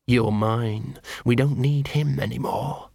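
a cursed weapon tempting its holder; sinister gun voice. Acted. The gun speaks to Vimes.

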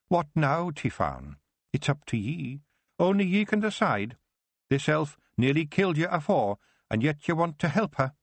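put-on a wee Scots elder cautioning the girl; Scottish Feegle Kelder. The Kelder speaks, Scots dialect.

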